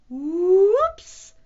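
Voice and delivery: high, rising, stretched "whoops"; loud whoops. A narrator's reading. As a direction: slide up into a big oops. Hat slips off.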